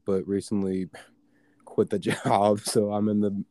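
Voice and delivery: laughing through the words; giggling while admitting quitting. Real, not acted.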